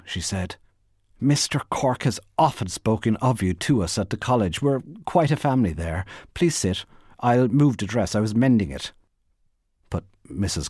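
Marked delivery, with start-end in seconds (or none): 1.2-8.9 higher, eager hostess voice; sociable, pitched-up small talk; polite Bernice. Acted. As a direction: chatter politely to a visitor. Bernice makes polite conversation.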